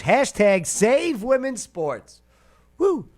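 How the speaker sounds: high slow chanted slogan; rally the crowd; cheering. Real, not acted.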